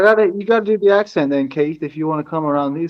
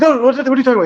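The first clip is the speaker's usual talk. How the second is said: high, indignant mock protest; imitating a guy getting defensive; defensive denial; 9 semitones higher, much faster than usual. Friend mimicking a defensive stranger, high and indignant. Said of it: "he gets all defensive".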